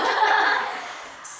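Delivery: airy unvoiced chuckle; breathy laugh. Real, not acted. Teacher laughs at cat joke.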